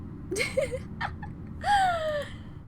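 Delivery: soft, high giggle with a long tail; high laugh. Real, not acted. Streamer amused at own confidence, high soft laugh. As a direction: giggle high, then let it trail.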